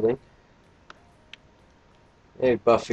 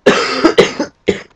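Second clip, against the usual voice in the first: cough; 7 dB louder than usual. Real, not acted. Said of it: "Oh, sorry about that".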